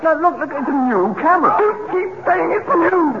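high-pitched, exasperated outburst; indignant protest. Acted. Indignant row over camera.

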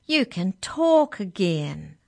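loud, drawn-out delight; happy cry. A narrator's reading. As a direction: exclaim joyfully, stretching the words. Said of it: "cried Arthur happily".

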